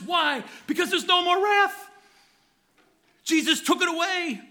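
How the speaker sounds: high, loud, rising preacher's cry; ringing pulpit exclamation; fervent preaching. Real, not acted. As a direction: lift them with the good news.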